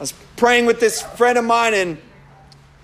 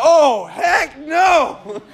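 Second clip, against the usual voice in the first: slow, high, emphatic outburst; comic refusal; 8 semitones higher, 3 dB louder, much slower than usual. Retold or imitated. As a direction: refuse in exaggerated alarm, dragging out each word. Acts his horrified refusal.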